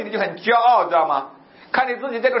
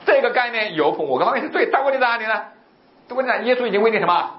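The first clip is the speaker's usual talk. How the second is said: ordinary talk, nothing marked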